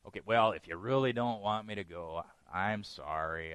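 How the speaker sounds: low, reluctant apology, dragging at the end; half-hearted apology. Retold or imitated. Balaam backing down before God, half-hearted apology. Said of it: "when our repentance is only half-hearted".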